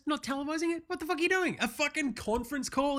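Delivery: high-pitched, outraged disbelief; incredulous rant. Real, not acted. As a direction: fire off questions in high, exasperated disbelief. Ranting at rushed draft process.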